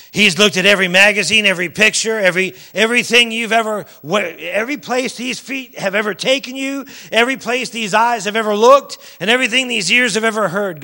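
loud, rising sermon build; loud preaching crescendo; full force preaching. Acted. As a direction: drive each line home to the congregation.

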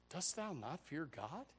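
soft, slow, earnest question; the penitent thief quietly rebuking his companion; hushed rebuke. Acted. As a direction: ask it quietly and gravely. Other thief's rebuke quoted.

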